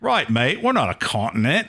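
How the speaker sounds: put-on Aussie accent. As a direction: play the offended Aussie in a passing Australian accent. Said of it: "stop doing my Aussie accent".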